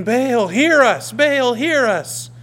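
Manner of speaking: loud, slow, pleading cry to an idol; crying out to Baal. Retold or imitated. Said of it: "crying out to him the way the prophets of Baal".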